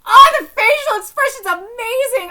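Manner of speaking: loud, high burst of admiration; thrilled fan reaction; excited gushing. Real, not acted. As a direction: gush loudly over the drawing.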